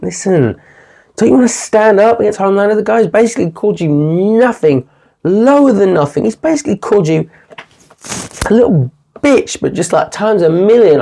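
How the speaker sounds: hammed-up scornful lecture; laying into him. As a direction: taunt him with rising scorn. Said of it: "she just laid it out for him".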